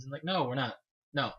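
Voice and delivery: ordinary talk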